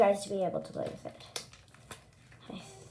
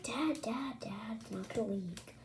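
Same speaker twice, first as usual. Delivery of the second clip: whispering; 10 dB quieter than usual. Retold or imitated. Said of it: "And I'm like".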